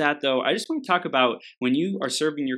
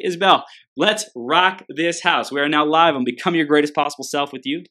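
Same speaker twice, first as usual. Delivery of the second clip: hype intro; 4 semitones higher, 6 dB louder than usual. Real, not acted. Podcast host welcoming her guest live, big hype call. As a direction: rev up the crowd like a live host.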